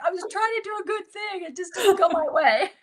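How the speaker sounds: high-pitched, laughing self-defence; mock-defensive protest. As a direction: plead your case in a squeaky-high comic wail. Retold protest to teasing kids.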